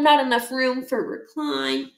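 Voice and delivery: plain reading voice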